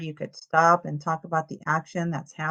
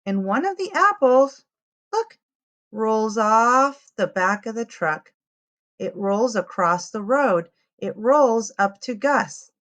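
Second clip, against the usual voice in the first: lively picture-book read-aloud; reading to children; 3 semitones higher, 5 dB louder than usual. A narrator's reading. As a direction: tell it to little ones, clearly and brightly.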